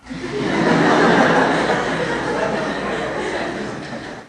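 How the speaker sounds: long breathy laugh out loud; unvoiced burst of laughter; real laugh. Real, not acted. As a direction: laugh at the answer. Laughs at daughter's answer.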